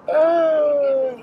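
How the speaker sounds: high-pitched helpless laughter; laughing hard. Real, not acted. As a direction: crack up and wheeze. Cracking up at grandma.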